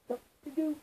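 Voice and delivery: usual speaking voice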